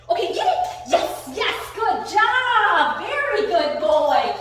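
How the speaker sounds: high, loud, drawn-out cheer for a dog; excited praise. Real, not acted. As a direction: whoop it up, stretching the praise words. Praising dog during tug game.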